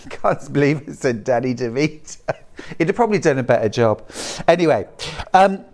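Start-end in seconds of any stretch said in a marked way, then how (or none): none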